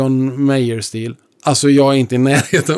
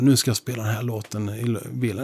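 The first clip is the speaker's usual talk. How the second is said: quiet, lower inner voice; soft inner thought; 3 semitones lower, 8 dB quieter than usual. Retold or imitated. Savoring music in the car, a quiet inner voice. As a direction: murmur it to yourself.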